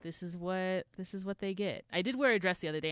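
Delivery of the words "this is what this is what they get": ordinary talk, nothing marked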